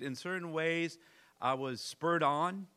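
ordinary talk, nothing marked

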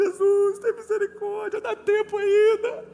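high, loud mock-crying wail; hammed-up tearful pleading. Preacher reenacting running crying down the street, loud wailing plea. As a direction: cry out desperately, stretching the plea, half comic. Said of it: "vou correndo e chorando".

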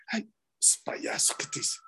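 soft whisper; whispered aside. Retold or imitated. Friend whispering about the man's burned skin, hushed. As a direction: whisper it so nobody hears.

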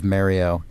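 "Mario" said MARE-ee-oh; first vowel of "Mario" as in "Mary"; New York pronunciation. Shown as a demonstration. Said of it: "Took me a long time to grow out of".

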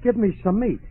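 high, loud begging; desperate pleading. Acted. Starving customer begs the butcher for meat, desperate pleading.

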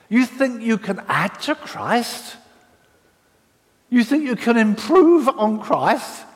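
angry apostle scolding his church; furious Paul. Acted. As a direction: tear into them like an outraged apostle. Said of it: "his angriest letter".

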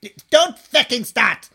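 loud, slow, high-pitched warning; stern warning. Acted. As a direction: cut off the quarrel forcefully. Boss cuts off kitchen quarrel.

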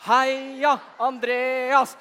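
slow, drawn-out half-hearted cheer for Andreas; a lukewarm group chant, not yet giving it all; weak cheer. Acted. Kids asked to cheer for Andreas, half-hearted cheer. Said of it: "det der er kjempedårlig".

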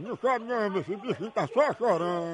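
soft, hushed soothing aside; quietly calm a crying baby mid-sentence; hushing a baby. Pretend. Caller soothes the pretend crying baby, soft hush.

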